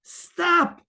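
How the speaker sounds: firm inner stop; sharp command. Shown as a demonstration. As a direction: snap it at your own thoughts. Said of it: "Tell yourself".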